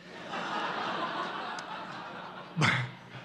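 airy wheeze of a laugh; a soundless laugh under the breath; breathy silent laugh. Real, not acted. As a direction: laugh without voice, falling away. Laughs at own story.

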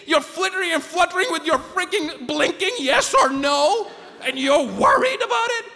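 high-pitched incredulous inner rant; exasperated self-scolding. Acted. Patient scolding himself over his helpless state, exasperated. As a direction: berate yourself in a high, worked-up voice.

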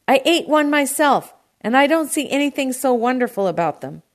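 loud, high, exasperated shout. A narrator's reading. Produce man dismisses the pomegranates to uncle, shouting. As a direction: argue back at a shout. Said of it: "the produce man shouted".

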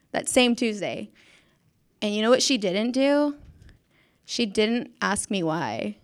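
normal talking voice

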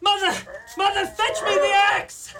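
calling down. Acted. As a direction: scream down to mother from up high. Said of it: "Jack called down to his mother".

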